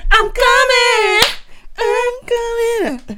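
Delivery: high, slow, drawn-out call; singsong mock summons reply; playful calling out. Playfully calling out over imitated dings.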